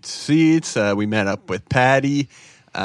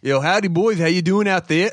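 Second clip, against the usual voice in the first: higher, mild Boston and English lilt; Boston-English mix; 6 semitones higher than usual. Acted. Asked to say it again, he repeats the Boston-English greeting. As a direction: greet the boys in a light Boston-English blend. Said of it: "a little Boston, a little bit of English".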